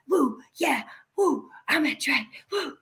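high, whispery mock whooping; hushed cheering. Fan re-enacting afterwards having met the guitarist, hushed cheering. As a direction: imitate small, breathless whoops of celebration.

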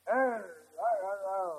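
slurred undead pirate impression; put-on pirate growl of a walking corpse. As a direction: talk like a dead pirate, softly. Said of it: "dead guy talks like a pirate".

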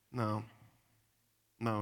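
ordinary delivery

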